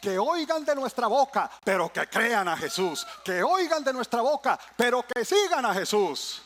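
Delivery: loud, driving sermon cadence; fiery preaching. Acted. Preacher to church, full-force preaching. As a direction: preach it out to the congregation.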